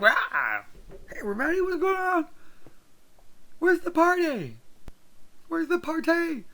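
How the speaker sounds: cartoon hype man yelling for the party; wired party guy. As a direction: greet the room like you've downed five energy drinks. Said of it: "he's totally wired".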